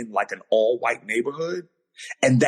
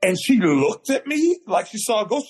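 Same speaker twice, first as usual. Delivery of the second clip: ordinary delivery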